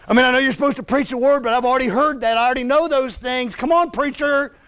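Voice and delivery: exasperated, whining pushback; frustrated complaint. Acted. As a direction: rush into it, then drag out the final plea. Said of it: "you're like, "Well, I know that".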